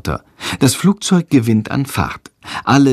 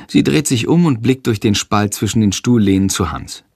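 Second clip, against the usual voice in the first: ordinary delivery, nothing marked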